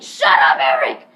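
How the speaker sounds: low, loud mock bellow; retold teacher's shout. Teacher silences repetitive Eric, low mock bellow.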